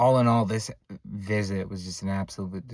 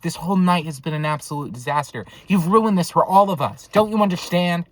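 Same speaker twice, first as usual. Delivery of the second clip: raised voice building to loud reproach; exasperated scolding; 6 semitones higher, 6 dB louder than usual. Retold or imitated. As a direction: tell off someone who spoiled everything. Scolding uncle, she was done.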